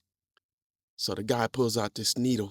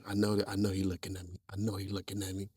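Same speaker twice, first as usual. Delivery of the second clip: nervous mutter; 3 semitones lower, 8 dB quieter, faster than usual. Retold or imitated. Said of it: "I'm like".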